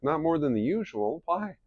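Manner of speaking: high puzzled reply; innocently confused. Retold or imitated. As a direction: friend puzzled by wife's question, high voice. Drew answers puzzled.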